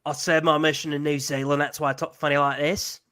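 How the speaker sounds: mock Kiwi accent on a self-introduction; fake New Zealand accent. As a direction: imitate someone faking a New Zealand accent. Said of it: "pretending that he has a New Zealand accent".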